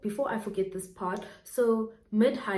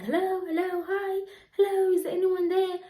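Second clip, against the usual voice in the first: much higher, louder, sing-song hello; eerie replayed greeting; 11 semitones higher, 4 dB louder than usual. Her own voice replayed to her on a stalker's call, eerie and much higher. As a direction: mimic her own voice played back creepily down the line. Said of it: "it's my voice on the other end saying exactly".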